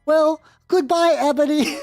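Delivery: high, whimpering house elf voice; house elf Dobby. Acted. Reading the fanfic aloud as Dobby, in a high pitiful voice. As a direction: squeak it out like a put-upon house elf.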